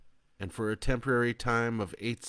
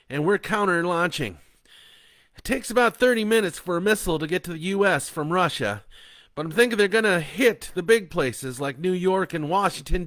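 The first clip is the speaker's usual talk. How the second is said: tense; 10 semitones higher, 10 dB louder than usual. Acted. Bo reasons under pressure.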